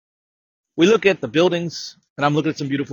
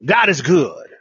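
slow, higher, louder call-out; rally the crowd on every word; preacher's call; 5 semitones higher, 3 dB louder, slower than usual. Retold or imitated. Preacher calling out to the congregation, louder and higher. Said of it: "And then I say".

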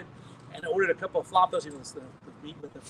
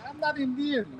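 mock-protesting in a light Indian accent, higher; 8 semitones higher than usual. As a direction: deny it while slipping into a playful Indian accent. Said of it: "all the voices I make are Indian".

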